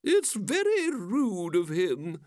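put-out lady, each word stretched; high, slow, affronted character voice; indignant moon. A narrator's reading. Moon's complaint voiced in poem.

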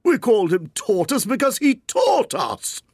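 high creature voice with a mild irritated edge; peevish turtle retort; mild angry Mock Turtle. A narrator's reading. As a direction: snap back impatiently. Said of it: "said the Mock Turtle angrily".

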